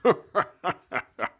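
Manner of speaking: a ghost's amused laugh; mocking male laugh. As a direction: laugh at her from inside her head. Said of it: "Deep male laughter filled her head".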